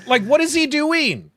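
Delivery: loud, high-pitched incredulous question; exasperated disbelief. Real, not acted. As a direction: exclaim it high, baffled and exasperated. Mocking the monster's silly behaviour.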